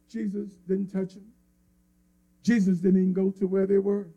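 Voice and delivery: ordinary delivery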